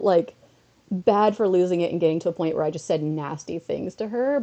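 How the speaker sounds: ordinary talk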